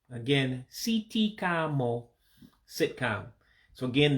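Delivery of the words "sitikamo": "sitikamo" slow, "see-tee-kah-mo", each I as E; "sitikamo" drawn out, ee vowels and final o. Shown as a demonstration. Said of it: "say that same word with an accent on it".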